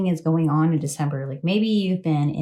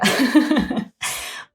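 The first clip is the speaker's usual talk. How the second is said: amused laugh after a warning; 7 semitones higher than usual. Real, not acted. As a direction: laugh it off at your own tangent. Laughs at her own warning.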